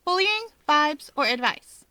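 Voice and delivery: cheeky menu of choices; playful listing; listing options. A narrator's reading. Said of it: "She lists on her fingers".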